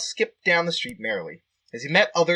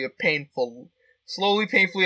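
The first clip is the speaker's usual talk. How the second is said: ordinary talk, nothing marked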